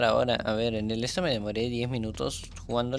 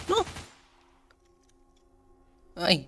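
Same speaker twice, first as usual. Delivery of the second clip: normal talking voice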